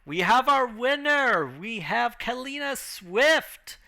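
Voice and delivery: high, drawn-out ring announcer call; big showy victory announcement. Acted. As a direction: proclaim the winner slowly to the whole arena. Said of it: "announcer just like".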